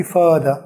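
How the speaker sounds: further with no r, fuh-duh; British further. Shown as a demonstration. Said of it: "और वो father".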